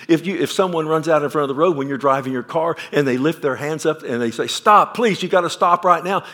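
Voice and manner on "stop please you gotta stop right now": much higher and louder plea; desperate shout. Acted. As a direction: yell to stop a car. Person in road flags driver.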